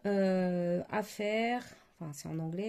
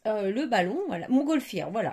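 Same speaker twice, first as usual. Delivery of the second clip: relieved find; 9 dB louder than usual. Real, not acted. Finally finding the word.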